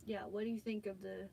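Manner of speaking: muffled, far-off sound; speaking with the mic unplugged. Real, not acted. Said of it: "Sounds like you're muffled".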